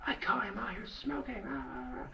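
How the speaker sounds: very high squeaky tattling imitation; mock parent voice. Parent explains the fight over smoking, high mock complaint. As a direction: mimic a complaining parent, high, fading into babble.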